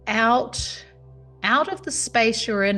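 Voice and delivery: normal talking voice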